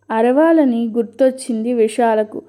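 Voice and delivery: usual speaking voice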